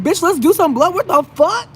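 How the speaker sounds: high, outraged sass; shrill-edged disbelief, fired back at him; exasperated retort. Retold or imitated. Patient snapping back at the doctor in high outrage. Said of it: "I'm like".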